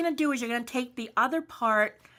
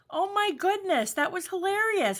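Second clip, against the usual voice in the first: amused; 8 semitones higher than usual. Real, not acted. Recovering from laughing fit.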